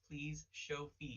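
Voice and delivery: drawn-out, pleading please; slow mock plea. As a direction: beg it out slowly, half-joking. Begging streamer in mock plea.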